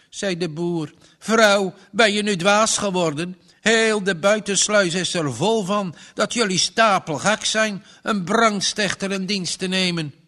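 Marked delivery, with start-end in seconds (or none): 1.2-10.1 loud, higher, exasperated farmer; angry scolding. Acted. Farmer berates Margje for hiring a convict, loud and angry. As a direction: scold her like she lost her mind.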